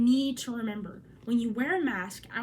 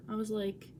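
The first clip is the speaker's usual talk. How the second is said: usual speaking voice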